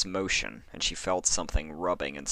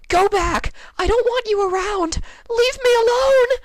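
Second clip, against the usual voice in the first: desperate high scream, much louder; 12 dB louder than usual. A narrator's reading. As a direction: shriek them away with everything. Lyra screaming at the fingers.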